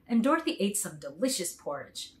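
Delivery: plain reading voice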